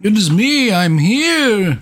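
high sing-song goofy greeting, slowed; silly high intro voice. Pretend. Host opening the podcast in a high goofy voice. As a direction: announce yourself like a cartoon sprite.

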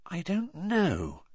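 thoughtful, stretched-out reply; pensive. A narrator's reading. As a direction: wonder aloud slowly. Pensive reply, drawn out slowly.

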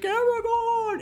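long, high, drawn-out call. Acted. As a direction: call his name across the cavern. Calling out to Aragorn.

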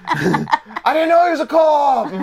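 high, slow Boston wail; hammed-up Boston, drawn out through laughter; bad Boston accent. Host joining in on the accent joke, slow high mock Boston. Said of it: "No one can do it".

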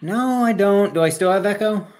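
ordinary talk, nothing marked

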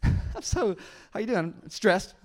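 high, chipper put-on greeting through a fake smile. Preacher showing the congregation how to say stressed, with a fake smile. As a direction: say it through a big phony smile. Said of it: "say the word stress with a fake smile".

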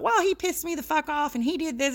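a lightly voiced grumbling father; imitating dad's gruff grumble. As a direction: play the grumpy dad griping it out. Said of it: "He's like".

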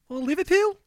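put-on a playful Liverpool accent; Scouse accent. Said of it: "That's pretty good".

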